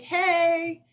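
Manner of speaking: a cheeky nudge by text; teasing hey. Retold or imitated. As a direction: friend pinging a funny photo, playful. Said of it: "just to be like".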